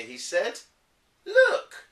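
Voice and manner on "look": look. Acted. Dad shows empty curtains.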